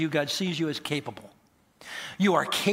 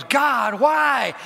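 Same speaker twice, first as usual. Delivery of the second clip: high, loud cry to heaven; anguished cry to God; 8 semitones higher, 6 dB louder than usual. Acted. Man whose house burned cries to God, acted anguished shout. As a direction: cry out in mock despair.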